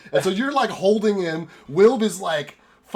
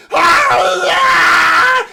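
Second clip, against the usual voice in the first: high, loud drawn-out cry; wild emotional howl; scream of excited pain; 14 dB louder than usual. Acted. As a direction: scream between elation and gut-wrenching pain. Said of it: "incredible in-, excitement and, like, gut-wrenching pain".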